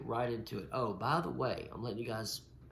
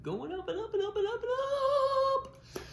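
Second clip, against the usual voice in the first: climbing pitch; 6 dB louder, faster than usual. Acted. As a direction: voice climbs higher and higher with each up. Numbers keep climbing, voice climbs.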